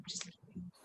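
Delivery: breathy whispered aside. Real, not acted. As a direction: whisper it playfully. Whispered joke after teasing.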